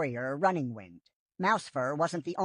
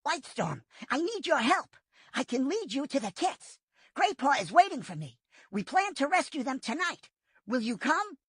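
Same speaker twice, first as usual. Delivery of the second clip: higher, pressing plea; urgent young cat; 10 semitones higher than usual. A narrator's reading. Firepaw urging Whitestorm to join the rescue, higher urgent voice. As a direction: beg for help without wasting time. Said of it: "He meowed urgently".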